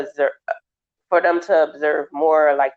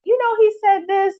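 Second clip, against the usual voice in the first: pitched way up, eager report; high excited parent voice. Retold or imitated. Therapist mimicking a proud parent, high voice. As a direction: tell proudly what he said.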